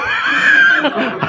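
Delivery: high loud cackle; loud burst of laughter. Real, not acted. (malakas na halakhak)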